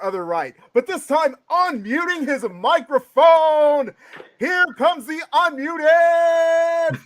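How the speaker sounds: big, loud announcer, names stretched out high; ring announcer intro. Pretend. Host presenting his co-hosts to listeners, hyped-up ring announcer. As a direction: build each introduction to a long, soaring shout.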